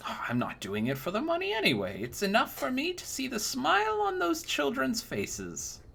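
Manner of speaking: put-on high, sweet old woman voice, fawning; a doting old crone cooing over a young knight; sugary old lady flattering the customer; old crone baker.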